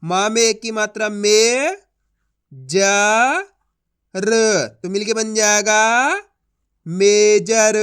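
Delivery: slow and loud, letter by letter; slow spelling-out demo again. Shown as a demonstration. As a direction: repeat the blending, then punch major. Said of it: "चलिए एक बार फिर से देखिए".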